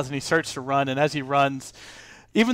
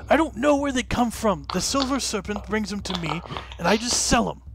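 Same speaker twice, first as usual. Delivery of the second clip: higher pleading auctioneer; 7 semitones higher than usual. Acted. GM voices auctioneer.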